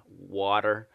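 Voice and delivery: "water" said the American way, tapped t and r-coloured ending; a clear US-accent "water". Shown as a demonstration. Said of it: "Americans pronounce it much better".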